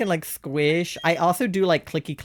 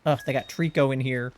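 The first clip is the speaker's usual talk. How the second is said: usual speaking voice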